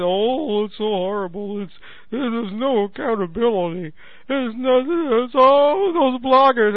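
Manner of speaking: drawn-out, hammed-up whining lament; mock moaning. TV pundits bemoaning the bloggers, mock moaning. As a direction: wail it in mockery, pitch climbing toward the end. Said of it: "bitching and moaning about the bloggers".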